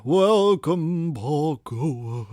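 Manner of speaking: slow eerie host greeting; creepy haunted-house welcome; spooky voice. Shown as a demonstration. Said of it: "you didn't do a voice".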